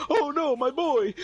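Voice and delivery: laughing, hammed-up cry for his son; mock anguish. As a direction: wail it like a hammed-up grieving dad while laughing. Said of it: "had the nerve to sit there and go".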